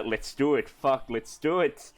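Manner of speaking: ordinary talk, nothing marked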